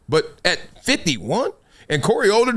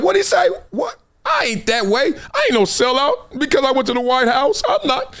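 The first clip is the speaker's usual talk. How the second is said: defensive outburst; 7 semitones higher than usual. Retold or imitated. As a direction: rush to defend yourself, rattled. Without that talk from my sister.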